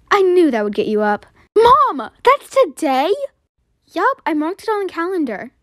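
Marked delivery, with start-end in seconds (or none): 1.6-3.1 put-on higher, louder thrilled kid; excited. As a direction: burst out with delight at the news. Kid thrilled camp is today.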